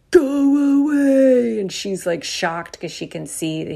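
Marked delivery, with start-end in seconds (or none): 0.1-1.6 slow, loud, higher monster snarl. Retold or imitated. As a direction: drag out the cry like a turning werewolf.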